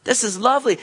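high, loud laughing cry of relief; joyful shout. Retold or imitated. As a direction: shout for joy at being freed.

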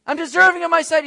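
loud, high demanding shout; acted entitled yell. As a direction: holler as if owed a miracle. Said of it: "he's not yelling out saying".

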